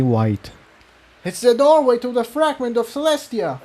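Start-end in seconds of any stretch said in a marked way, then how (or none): 1.2-3.6 shouted. A narrator's reading. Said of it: "Jigsaw shouted to Tiptoe".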